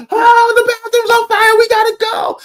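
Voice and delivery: very high, loud screamed alarm; mock panicked scream. Imitating a wife who woke from a dream screaming about fire, panicked. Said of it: "And she just screamed".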